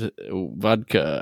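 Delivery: slow, low, bad Russian accent; terrible Russian accent. Friend running out of pick-up line, bad Russian accent. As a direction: fumble on slowly in fake Russian. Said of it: "That is a terrible Russian accent".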